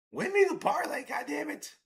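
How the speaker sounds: high, loud begging for the pitcher; hopeful yell at the game; pleading cheer. Real, not acted. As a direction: urge the pitcher on desperately. Begging for the strikeout.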